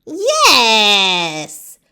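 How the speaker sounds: bright eager cry of yes. Acted. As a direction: call out an excited yes. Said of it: "said the witch".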